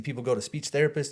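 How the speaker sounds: ordinary talk, nothing marked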